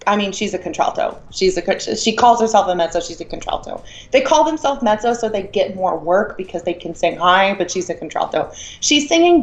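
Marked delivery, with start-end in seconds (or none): 3.0-3.8 hushed, under the breath; whispered aside. Real, not acted. Whispered aside on her voice type.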